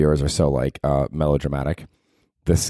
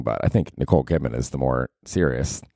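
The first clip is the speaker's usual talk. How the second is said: ordinary talk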